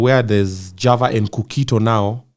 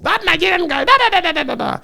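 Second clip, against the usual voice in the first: high mock voice. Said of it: "The comments were just".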